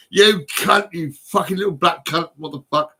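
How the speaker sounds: loud angry swearing, recalled; retold angry outburst. Punched man recalling his rage, loud angry outburst. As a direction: spit it out like he's back there. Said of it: "I was just stood there thinking".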